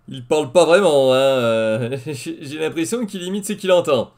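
normal talking voice

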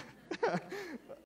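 a real high burst of laughter. Real, not acted. Pastor laughs at himself in front of the congregation, amused. Laughs after his cheer.

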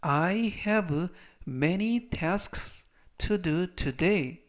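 I have many tasks to do today read very slowly; teacher reading the sentence slowly for students to repeat; very slow model reading. Shown as a demonstration. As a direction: stretch every word for learners to repeat. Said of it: "제가 역시 천천히 세 번씩 읽어드리고요".

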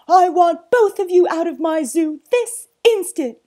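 high, very loud command; bellowing. Acted. Zoo owner throwing both out, bellowing. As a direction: order them out furiously. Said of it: "bellowed Sir Basil".

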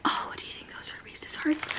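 hushed, excited whisper. Retold or imitated. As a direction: whisper it like spotting a secret treasure. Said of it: "I was like".

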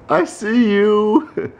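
high slow creepy tease; drawn out spooky I see you. Pretend. Creepy playful voice.

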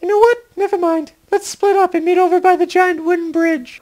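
high-pitched woman's voice; high female voice. Acted. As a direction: play her high and light. Elena voiced by same speaker.

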